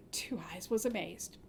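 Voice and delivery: hushed, amazed whisper; whispered wonder. A narrator's reading. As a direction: breathe it out in awe.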